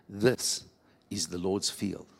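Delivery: put-on low, measured old man's voice; solemn old man. Old man telling David whose field it is, low and solemn. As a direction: state it low and quietly, lightly voiced. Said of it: "then the old man says to him".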